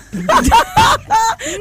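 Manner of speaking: real laughing fit, way up high; shrieking laugh. Real, not acted. Laughing at own story.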